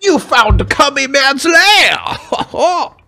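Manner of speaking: high theatrical villain voice with gloating laugh; hammy high-pitched cartoon villain; mustache twirling villain. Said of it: "mustache twirling villain".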